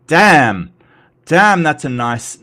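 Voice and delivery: loud, impressed damn with a falling tune; impressed exclamation. Real, not acted. Streamer sees a flood of emojis, loudly impressed. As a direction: blurt it out, wowed.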